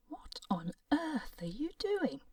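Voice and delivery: high, slow, astonished cry; astonished exclaim. Acted. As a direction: stare in disbelief and ask. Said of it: "the other doctors exclaimed".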